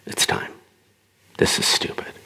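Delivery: hushed inner voice. Acted. Inner voice urges him to end the argument, hushed to a whisper. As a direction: whisper it like a private inner prompting. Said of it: "deep down inside, there's something that's saying".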